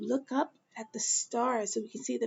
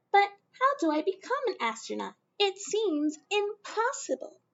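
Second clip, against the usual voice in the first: put-on higher, quick worried child voice; little Mae; 8 semitones higher than usual. Young Mae asking her dad how to become an astronaut, higher and quick. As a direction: rush it like a doubtful girl.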